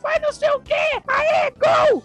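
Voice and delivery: put-on very high girly cheering; cheerleader. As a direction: squeal it like a crowd of cheering girls. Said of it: "Aí as meninas lá torcer, ficava".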